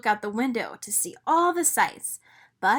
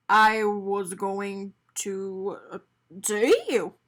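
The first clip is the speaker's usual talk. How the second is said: lower, halting stunned man; Mister Bing in shock; 3 semitones lower, slower than usual. A narrator's reading. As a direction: fumble for words, caught out. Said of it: "he replied in shock".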